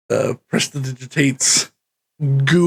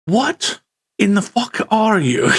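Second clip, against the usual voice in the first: higher put-on voice, stunned question; baffled npc; 6 semitones higher than usual. GM voicing the npc, baffled by the splashless drop, in a higher put-on voice. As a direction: gape at the odd player character. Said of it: "he's like".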